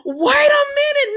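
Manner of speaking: high loud squeal of disbelief; real shock, voice shooting up; shocked cry. Real, not acted. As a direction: cry out like you can't believe it. Repeats shocked exclamation.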